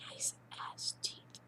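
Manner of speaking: slow awed whisper with a gasp; breathy whisper. Real, not acted. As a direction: whisper it, lost in admiration. Gasping admiring whisper.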